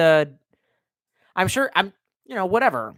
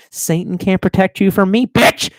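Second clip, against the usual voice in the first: louder, higher mock menace; hammed-up threat; 4 semitones higher, 6 dB louder than usual. Streamer taunting Bill Gates in mock menace, loud. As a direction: bark it like a movie villain.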